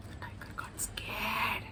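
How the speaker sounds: breathless whisper after a gasp. A narrator's reading. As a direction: hush it as the tiger gets scared. Narrator whispers fear.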